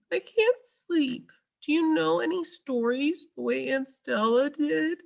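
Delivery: put-on a small child who can't sleep; little elephant voice. A narrator's reading. Ruby asks Ivan for a story, high and small.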